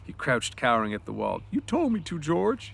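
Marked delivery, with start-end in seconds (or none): 1.5-2.7 high, small plea; miserable. Acted. Lenny cowers and explains to George, miserable. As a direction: say it like a hurt child excusing himself. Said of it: "he said miserably".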